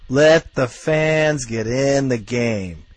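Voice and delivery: "Let the fans get in the game" dragged out word by word; slow emphatic repeat. Real, not acted. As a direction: hammer each word slowly home.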